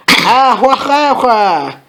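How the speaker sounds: high, spelled-out fake cackle; deliberate hammed-up "hwa hwa" laugh; mock cackle. Dumbledore scoffing at the dream, mock cackle. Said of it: "Dumbledore started to cockle".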